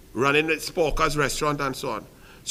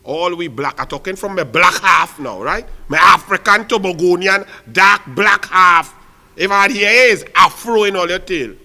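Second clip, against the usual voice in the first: defiant shout; 8 dB louder than usual. Real, not acted. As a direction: proclaim it with pride.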